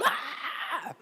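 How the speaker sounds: sudden high frightened yelp; panicked scream. Retold or imitated. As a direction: shriek like you just saw a ghost. Disciples panic at Jesus walking.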